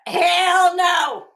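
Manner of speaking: loud, high, full-throated refusal; emphatic hell no. Real, not acted. Answering a viewer's question in chat with a loud laughing shout. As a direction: belt it out like there's no way.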